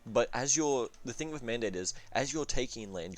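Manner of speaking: ordinary talk, nothing marked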